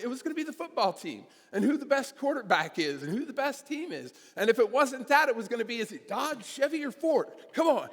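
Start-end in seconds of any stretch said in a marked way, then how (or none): 5.8-7.9 imitating arguing dads. Dads arguing about car brands.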